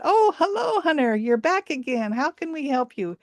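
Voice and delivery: high friendly monkey voice inviting the lost hunter in; old monkey voice. Acted. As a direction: welcome him in like a kindly old monkey. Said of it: "and they said".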